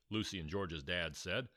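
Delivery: ordinary delivery, nothing marked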